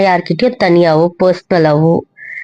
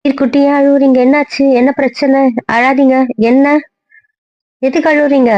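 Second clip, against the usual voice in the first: put-on higher-pitched coaxing baby talk; soothing a child; 6 semitones higher than usual. Woman comforting a crying child on the phone, soothing. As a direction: gently ask the little one what's wrong.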